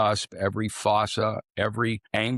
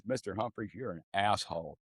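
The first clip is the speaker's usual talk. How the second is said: much softer, flat deadpan delivery; dry quiet put-down; 9 dB quieter than usual. Retold or imitated. Dental teacher quietly calls the joking student an asshole, deadpan. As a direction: drop the voice and deliver the insult dead dry.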